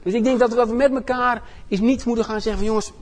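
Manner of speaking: preaching. Acted. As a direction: lift the room like a revival preacher. Preaches at full force.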